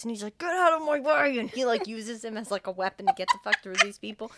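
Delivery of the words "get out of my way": high, loud imitated command; acted-out urgent shout; shouted order. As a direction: yell to clear a path. Shoving through staff with wheelchair.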